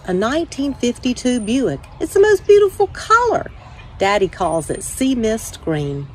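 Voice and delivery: put-on calm, steady read-aloud voice for children; story reading. A narrator's reading. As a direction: tell it gently to young listeners, like at story time. Reads children's picture book aloud.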